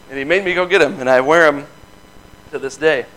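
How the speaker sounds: usual speaking voice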